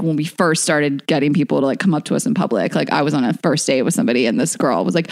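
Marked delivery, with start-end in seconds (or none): none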